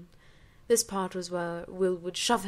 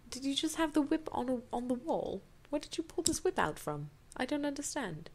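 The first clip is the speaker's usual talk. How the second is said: high, incredulous aside; high, baffled aside about the whip; 7 semitones higher than usual. Real, not acted. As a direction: question the story in disbelief. Reader breaks off to mock story.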